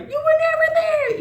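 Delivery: very high, loud mock-tearful whine; emotional bratty kid. Mocking the estranged son confronting his dad, high emotional whine. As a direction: imitate a little boy throwing an emotional fit. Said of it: "get all emotional".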